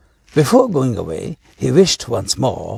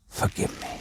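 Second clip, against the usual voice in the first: breathless, voiceless plea; fading whisper of a wounded man; whispered last plea; weak whisper; 11 dB quieter than usual. A narrator's reading. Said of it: "in a weak voice".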